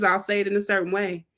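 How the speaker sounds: ordinary delivery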